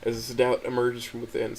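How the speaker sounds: ordinary delivery, nothing marked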